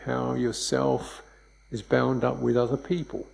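normal talking voice

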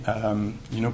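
usual speaking voice

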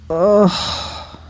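breathy sighed-out "ugh"; kvetching groan. Shown as a demonstration. Teacher urging class to breathe and kvetch, breathy groan. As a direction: groan out the body's complaint on a breath. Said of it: "allow yourself to breathe and quetch".

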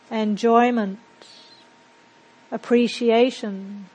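measured meditation guide with long, settling pauses; guided meditation. Acted. Teacher guiding a group to settle into silence and breathe, guided meditation. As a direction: lead them into calm, leaving space between phrases.

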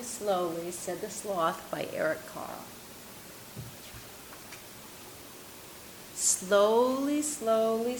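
measured storytelling with long, drawn-out refrains; unhurried storybook narrator, lifting the animals' questions; picture-book reading. A narrator's reading. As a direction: read it slowly to children, pausing between pages. Reads picture book aloud to group.

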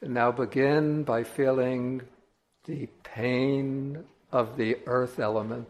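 measured meditation voice with long silences between phrases; solemn, unhurried contemplative delivery; guided meditation. Acted. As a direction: guide listeners slowly, drawing out key words.

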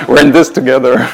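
amused, laughing retelling; laughing mock protest. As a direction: imitate an insistent friend while cracking up. Laughs acting friend's protest.

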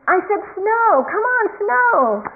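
high, loud mock demand, stretched at the end; retold yelling. Cartoon boy looking up at the heavens, insistent mock yell. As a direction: holler impatiently at the sky, hammed up. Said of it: "he's yelling up at the heavens".

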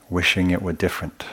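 ordinary talk

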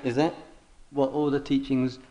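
ordinary talk, nothing marked

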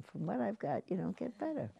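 soft, calm statement of acceptance; calm and wise. Retold or imitated. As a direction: say it softly, like hard-won wisdom. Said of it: "Maybe it was a statement of great wisdom".

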